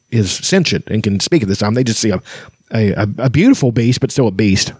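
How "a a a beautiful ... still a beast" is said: normal talking voice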